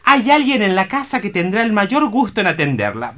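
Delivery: ordinary talk, nothing marked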